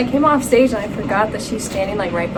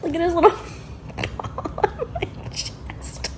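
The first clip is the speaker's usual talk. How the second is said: giggly whisper; 6 semitones higher than usual. Real, not acted. Giggling at the dog's paw.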